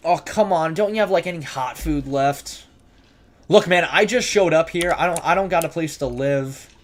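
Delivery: mock pleading. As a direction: beg the cook like a hungry drifter. Pleading with a shopkeeper.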